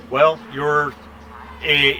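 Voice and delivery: normal talking voice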